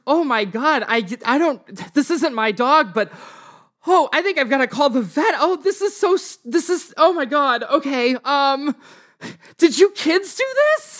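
high, flustered homeowner voice; flustered woman, pitched well up; distressed woman homeowner. Acted. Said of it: "She runs down her porch steps and she's like".

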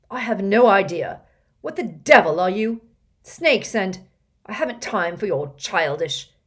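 loud, flustered sputtering; splutter, losing composure. A narrator's reading. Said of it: "he sputtered".

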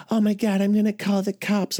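mimicking a frightened girlfriend about to call the cops; panicky girlfriend. Girlfriend panicking, voiced high.

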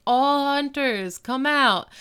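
high, drawn-out mock call; imitated yell. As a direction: call out to the whole clan, half-shouted. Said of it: "so Uncle Mike yells".

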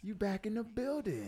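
hyped. Real, not acted. Hyped greeting.

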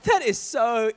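slow, put-on eager interest; mock fascination. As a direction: pretend to be enthralled, laying it on. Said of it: "I'm like".

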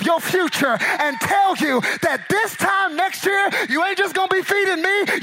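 loud, high, impassioned preaching; preaching at full force. Acted.

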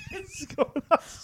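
laughing while talking; squeezed out through laughter. Real, not acted. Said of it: "He's losing it".

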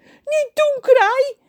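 very high, loud screeching plea; panicked yell. Acted. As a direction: shriek at the crow to stop. John gepikt door Kraai. (hoge schreeuw naar Kraai)

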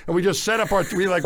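ordinary delivery

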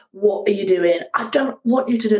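ordinary talk

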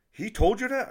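loud, high-pitched disbelief; imitated shocked question; incredulous outburst. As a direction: burst out in disbelief. Clerk's shocked reaction acted out.

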